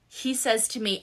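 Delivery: ordinary talk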